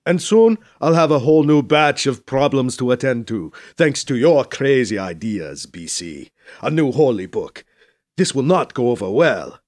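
weary complaint in a put-on statesman's voice; grumbling dignitary. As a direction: scold a colleague, but fondly.

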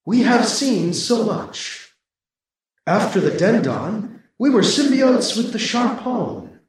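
slow, distant and wistful; nostalgic ancient voice. A narrator's reading. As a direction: remember eons gone by. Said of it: "glowing nostalgia".

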